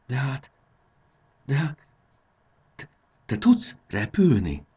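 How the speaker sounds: high, halting amazement; astonished. Acted. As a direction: stammer in disbelief, slowing on the question. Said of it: "hüledezett Marci". (hüledezve, akadozva)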